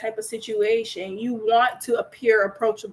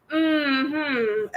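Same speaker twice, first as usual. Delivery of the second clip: dismissive mm-hmm; 4 semitones higher than usual. Retold or imitated. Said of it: "Was that a like".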